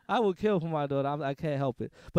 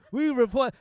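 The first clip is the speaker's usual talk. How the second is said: mock news anchor; 5 semitones higher, 7 dB louder than usual.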